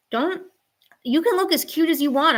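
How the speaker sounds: high-pitched warning to a pet; high cat-talk. Real, not acted. Streamer tells cute cat no, high pet voice. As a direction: raise the voice like talking to a kitten.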